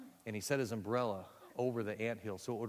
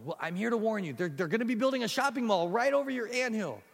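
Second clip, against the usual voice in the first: high, loud stammering; flustered boy; 10 semitones higher, 6 dB louder than usual. Acted. Boy stammers to the guard ant, high and flustered. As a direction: trip over every word in panic.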